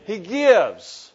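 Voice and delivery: preacher driving the refrain home; loud, emphatic answer. Shown as a demonstration. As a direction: punch it out loud. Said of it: "say it with me".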